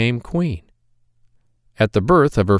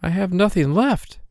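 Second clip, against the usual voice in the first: put-on higher, sad young maiden; maiden's lament; 9 semitones higher than usual. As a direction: sigh it out as the worried girl. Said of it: "said she".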